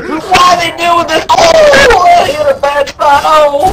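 very high, loud pained squeal; snake screaming. Pretend. The snake begging Snake God mid-torture, high shrieking voice. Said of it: "he'd be screaming and praying".

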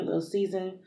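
ordinary delivery

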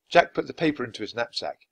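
plain reading voice

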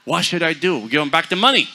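loud, high retort; loud mock exchange. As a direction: belt the answer to the crowd. Quoting and answering loudly.

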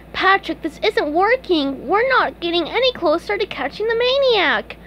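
high-pitched cartoon complaint; exasperated SpongeBob. SpongeBob tells Patrick the plan is failing, exasperated. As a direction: pitch it up, fretting over the plan.

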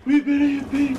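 very high, loud squeak; squeaky joke voice. Pretend. Joking squeaky line.

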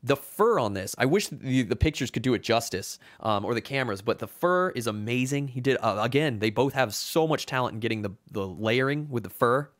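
ordinary delivery

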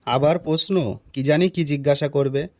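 low-pitched talking to oneself; nervous aside. Acted. Teacher steels himself for a pupil's question, low aside. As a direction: keep it low, a private thought.

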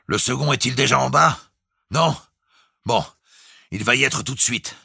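high-pitched questioning call. A narrator's reading. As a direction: call down the speaking tube for news. Calling through the speaking tube. (appel interrogatif à voix haute)

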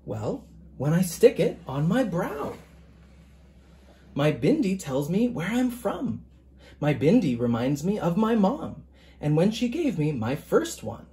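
gentle picture-book reading, even and measured; story narration. A narrator's reading. Author reading his picture book to listeners, storytelling voice. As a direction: read it aloud to a class of children.

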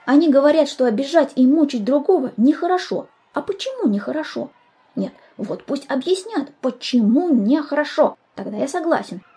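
high-pitched, insistent protest; indignant arguing. Acted. Schoolboy disputes the rules, indignant. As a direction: demand an explanation, starting loud. Pupil argues indignantly at the meeting.

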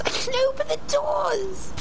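very high-pitched delighted squeal; excited squeal. Real, not acted. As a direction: squeal with discovery. Excited discovery in the game.

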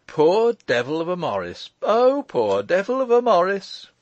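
high, loud cry of delight, building. A narrator's reading. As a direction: crow with glee, climbing on the repeat. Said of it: "cried the lawyer in delight".

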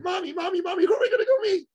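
put-on high-pitched, eager child voice; excited little boy. Little son asking his mother, excitedly. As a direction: imitate a small boy bursting to know. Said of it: "in that excited way little boys do".

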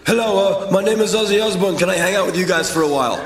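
high, fast, put-on rock legend voice. Rock legend asking the band to hang out, high put-on voice. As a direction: do the famous rocker, friendly and high. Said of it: "[name] walks in".

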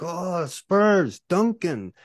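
light up with sudden joy; excited recognition. Retold or imitated. Micronesians recognizing Tim Duncan, excited and high. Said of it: "then they're like".

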